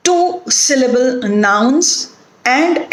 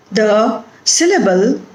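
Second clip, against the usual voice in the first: ordinary delivery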